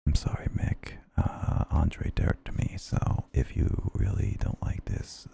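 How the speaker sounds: ASMR whisper. Acted. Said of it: "five minutes of A S M R".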